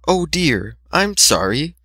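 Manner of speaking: high, soft timid apology; timid Fluttershy. A narrator's reading. Fluttershy voiced.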